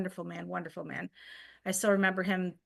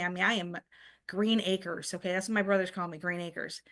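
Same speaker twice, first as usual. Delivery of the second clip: usual speaking voice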